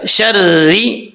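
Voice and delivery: شرری slowly with a light, thin ra. Shown as a demonstration. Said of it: "را باریک پڑھا جائے گا". (شرری میں را باریک)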